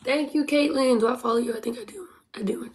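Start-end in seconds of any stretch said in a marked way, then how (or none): 1.6-2.7 whispered aside to herself; whispering. Real, not acted. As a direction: breathe it out under her breath. Aside while checking follow.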